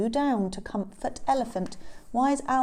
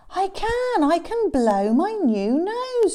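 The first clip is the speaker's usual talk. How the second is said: high, triumphant exclamation; trumpeting delight; 10 semitones higher, 5 dB louder than usual. Acted. Elephant to Owl about his new nose, trumpeting delight. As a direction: proudly blare it out. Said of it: "trumpeted Elephant".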